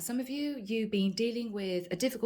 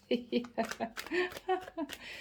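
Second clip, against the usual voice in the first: soft laugh; 3 semitones higher, 7 dB quieter than usual. Real, not acted. Laughing while reading the cards.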